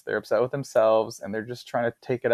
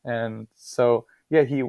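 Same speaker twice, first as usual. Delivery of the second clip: ordinary talk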